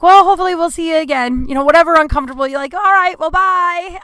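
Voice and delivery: fake-bright brush-off voice; awkward high goodbye. Waitress brushing off a flirty man, forced cheery.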